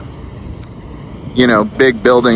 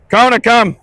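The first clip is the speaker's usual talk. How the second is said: calling the dog; 12 semitones higher than usual. Real, not acted. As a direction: call her in from afar.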